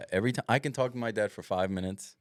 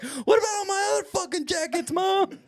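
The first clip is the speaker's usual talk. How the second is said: high, laughing mock outrage; whiny exasperated son, pitched up; mock indignant son; 3 dB louder than usual. Son snapping at mom over jackets, high laughing mock outrage. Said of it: "He's like".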